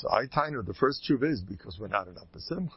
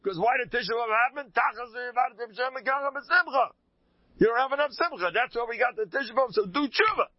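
high, insistent preaching cry; impassioned exhortation; 12 semitones higher than usual. Acted. Rabbi urging those who need Mussar, impassioned exhortation. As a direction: drive it home on a sustained high pitch.

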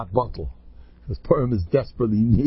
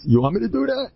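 high, rising incredulous question; pitched-up sceptical offer; mock offer; 11 semitones higher than usual. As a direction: challenge them in exaggerated disbelief. Repeats the mocking offer.

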